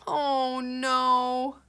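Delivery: high, loud, drawn-out dismay; character's dismay. Acted. Rosie reacts in the puppy story, dismayed wail. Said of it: "sound like their voice here".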